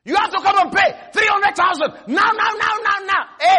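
high, insistent shouting; acted creditor in a high, urgent yell; demanding debt collector. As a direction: demand payment, hammering each word high.

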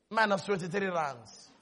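low, soft letdown; deflated readout. Retold or imitated. Checking the bank again at evening, quietly deflated. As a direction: drop low and quiet on the bad news.

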